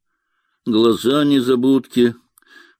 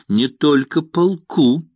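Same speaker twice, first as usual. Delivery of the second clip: slow, spiteful retort, a bit higher and louder; 6 semitones higher, 4 dB louder than usual. A narrator's reading. Nina answers her lover with slow spite. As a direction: bite the words out slowly in spite. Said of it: "злобно сказала Нина".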